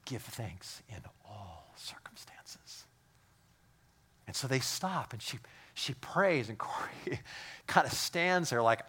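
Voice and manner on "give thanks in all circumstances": slow, unvoiced, soft reminder; hushed whisper. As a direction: gently press her faith in a whisper. Betsy answering Corrie in barracks.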